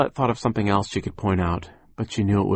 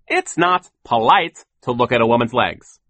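impatient; 3 semitones higher, 7 dB louder than usual. A narrator's reading. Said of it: "Sir said impatiently".

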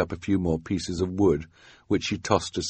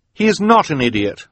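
high, loud, fierce retort; 10 semitones higher, 7 dB louder than usual. A narrator's reading. Violet defending Quigley from Esme's insult, fierce. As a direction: defend him hotly. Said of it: "Violet said fiercely".

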